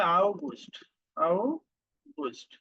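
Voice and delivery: আউগুস্ট said with an "ow" at the start, German style; আউগুস্ট, slow careful German pronunciation; German August. Shown as a demonstration. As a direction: drawl আউগুস্ট slowly, ow-goost. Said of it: "আগস্টকে বলে".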